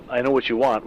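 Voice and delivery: normal talking voice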